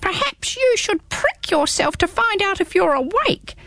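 squeaky small creature, teasing; little hedgehog voice. Acted. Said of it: "suggested the hedgehog".

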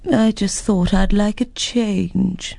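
slow, lightly put-on dragon voice; unhurried creature, a hint of voice; mild dragon. Dragon explains arrival.

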